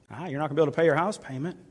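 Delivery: quick sneering taunt, rushed; taunting Satan. Acted. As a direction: rattle it off to scare. Satan voiced mocking with fear.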